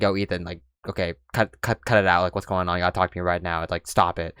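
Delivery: low, soft imitation of a coworker intervening; not angry, imitated. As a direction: quietly tell them to cut it out, without anger. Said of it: "she wasn't angry about it".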